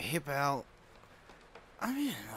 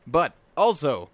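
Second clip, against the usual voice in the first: normal talking voice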